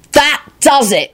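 slow, high, exasperated yell. A narrator's reading. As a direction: snap and cry out, fed up. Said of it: "he yelled".

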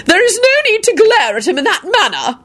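high, haughty irritation; irritable sniff. A narrator's reading. Woman at dinner reproving Quentin, sniffing irritably. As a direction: sniff it snootily, pitched high. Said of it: "Caroline sniffed irritably".

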